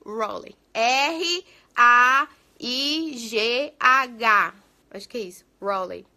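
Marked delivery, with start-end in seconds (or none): 0.0-0.6 "Raleigh" repeated low as RAW-lee; "Raleigh" with an English R; corrected pronunciation. Shown as a demonstration. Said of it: "não é assim que pronuncia". 0.8-4.5 very slow, louder letter by letter; each letter stretched and separated; spelling out. Shown as a demonstration. Spelling out the city name.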